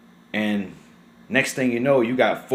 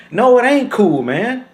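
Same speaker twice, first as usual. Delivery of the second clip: sharply higher and louder; indignant retort; 9 semitones higher, 6 dB louder than usual. Real, not acted. Answering the bystander who shrugs it off, indignant. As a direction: snap back that it ain't cool. Rebutting bystander's "it's cool.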